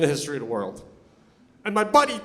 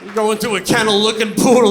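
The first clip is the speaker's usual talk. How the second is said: ordinary talk, nothing marked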